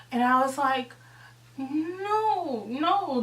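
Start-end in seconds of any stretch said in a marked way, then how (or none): 1.6-3.2 drawn-out, higher-pitched no; retold refusal. Girl answering her friend's apology, drawn-out refusal. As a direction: stretch each no in acted disapproval.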